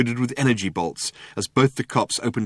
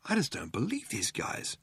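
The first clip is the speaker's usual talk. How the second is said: Ford muttering; 11 dB quieter than usual. A narrator's reading. Ford in disbelief at these guys, muttering. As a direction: mutter it under your breath. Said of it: "muttered Ford".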